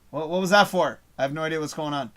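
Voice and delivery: normal talking voice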